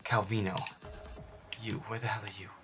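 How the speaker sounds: ordinary talk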